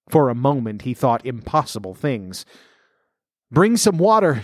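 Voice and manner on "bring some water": high, urgent call for water; cried out. A narrator's reading. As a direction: call out sharply, pitched up. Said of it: "she cried".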